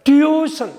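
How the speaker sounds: "டியூஷன்" as "tyoo-shun", high first syllable; correct pronunciation. Shown as a demonstration. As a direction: pitch up "டியூஷன்" with a y-glide after t. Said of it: "இந்த பாருங்க".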